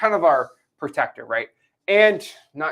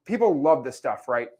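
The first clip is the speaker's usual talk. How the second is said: usual speaking voice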